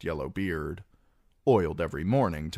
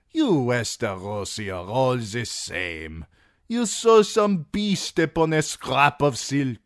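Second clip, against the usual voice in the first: higher and louder, mocking merchant; a fat magister laughing at lordly sigils; voice of Illyrio; 7 semitones higher, 5 dB louder than usual. A narrator's reading. Magister amused at Tyrion's lion boast, mocking.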